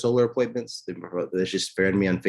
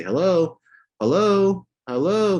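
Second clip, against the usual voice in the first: repeated hello; 11 semitones higher, 6 dB louder, much slower than usual. Retold or imitated. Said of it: "They say".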